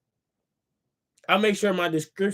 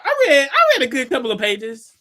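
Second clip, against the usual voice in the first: higher, laughing through the words; 6 semitones higher, 6 dB louder than usual. Real, not acted. Laughs at himself.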